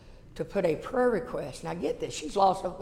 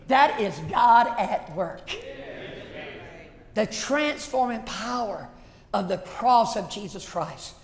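slow emphasis; slower than usual. Acted.

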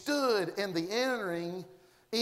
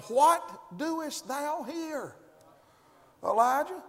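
a slow, lightly voiced God asking; 6 semitones higher, much slower than usual. God slowly asking Elijah why he hides. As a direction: draw it out slowly like the Lord calling.

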